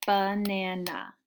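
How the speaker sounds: slow ba-na-na in three beats; clapped syllables. Shown as a demonstration. Teacher sounding out banana slowly for kids. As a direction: draw Banana out in three claps. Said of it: "Let's double check it".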